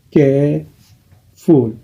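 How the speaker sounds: "Careful" said again very slowly and evenly; slow repeat. Shown as a demonstration. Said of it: "deux syllabes". ("Careful" répété très lentement)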